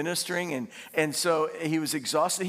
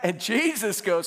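normal talking voice

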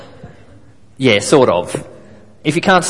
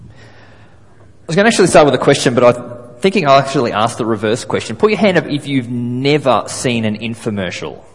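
usual speaking voice

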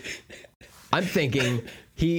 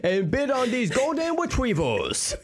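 very high, silly socialite with muddled Rs; Bruce Wayne; 12 semitones higher than usual. Acted. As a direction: address the gala crowd in a high, speech-impaired voice. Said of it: "I'm introducing Bruce Wayne".

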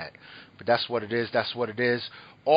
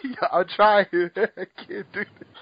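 higher voice breaking into real laughter; laughing helplessly; 7 semitones higher, 3 dB louder than usual. Real, not acted. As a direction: try to talk through the giggles. Laughing too hard to continue intro.

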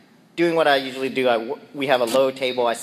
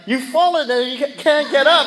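much higher, louder mock alarm voice; mock old-lady ad voice; 12 semitones higher, 5 dB louder than usual. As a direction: ham up the old-lady ad line. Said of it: "you're like".

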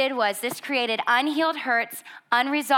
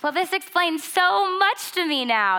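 higher-pitched, emphatic realization; mock revelation; 7 semitones higher than usual. Reacting to the anger score after laughing, exaggerated realization. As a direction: play up the sudden understanding.

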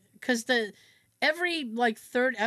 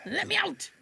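high-pitched theatrical cry; hammed-up trapped plea; Scrooge pleading; 10 semitones higher than usual. Miser begging the spirit to release him, melodramatic pleading.

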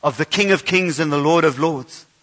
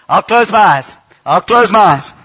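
loud playful; 6 dB louder than usual. Acted. Game with children on stage.

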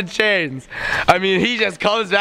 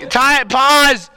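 ordinary talk, nothing marked

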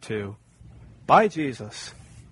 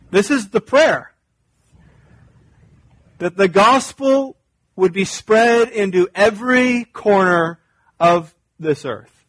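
loud, higher, slow appeal; fervent preaching; 5 semitones higher, 7 dB louder, slower than usual. Acted. Pastor pleads with the congregation, preaching at full force. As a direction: urge them to hear God's heart for the nations.

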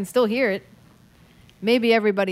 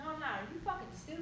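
from another room; 22 dB quieter, much faster than usual. Real, not acted. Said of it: "Ready from the other room".

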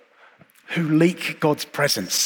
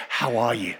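soft gentle greeting; 4 semitones lower, 5 dB quieter than usual. Retold or imitated. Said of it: "All he needed to do was say".

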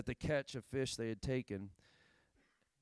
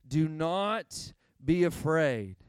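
"Do not be afraid" slow and louder, each word weighted; slow, emphatic; 2 semitones higher, 5 dB louder, much slower than usual. Shown as a demonstration. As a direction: lean on "Do not be afraid" like a promise. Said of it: "Say it one more time".

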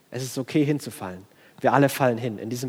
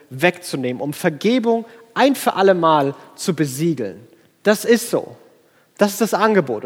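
louder, higher, building proclamation; rousing sermon climax; full-force preaching; 5 semitones higher, 6 dB louder than usual. Acted. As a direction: preach it out with mounting fervour.

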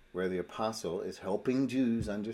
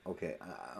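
usual speaking voice

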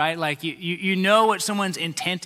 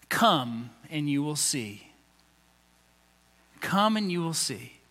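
gentle invitation; 5 semitones lower, 6 dB quieter than usual. Acted. Jesus's invitation, slow and soft.

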